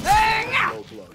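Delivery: high, loud, slow mock yell; Luke's outraged cry. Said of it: "Luke was just like".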